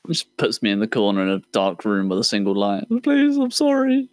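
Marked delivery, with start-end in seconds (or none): 3.0-4.1 high, slow mock begging; pleading captive. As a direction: whimper it like a terrified prisoner.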